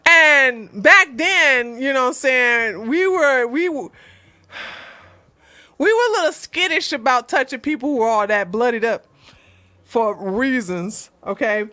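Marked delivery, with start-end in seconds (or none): none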